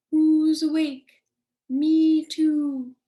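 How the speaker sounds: great horned owl call. Shown as a demonstration. Teacher showing children what the great horned owl says, hooting loudly. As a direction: hoot it slowly and loudly like an owl. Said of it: "the great horned owl says".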